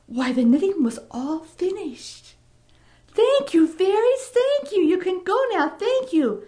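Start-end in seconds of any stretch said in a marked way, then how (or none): 3.1-6.4 put-on eager old woman. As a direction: gush thanks to shoo them out. Old woman thanks the fairies.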